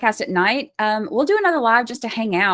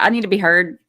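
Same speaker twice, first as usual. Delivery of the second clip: usual speaking voice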